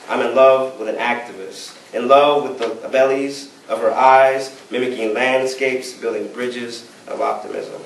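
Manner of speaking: rhythmic performance poetry, lines punched at the start; spoken-word poem. Acted. Poet performing a love poem about his activist wife, spoken-word delivery. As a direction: recite it in rolling, emphatic phrases.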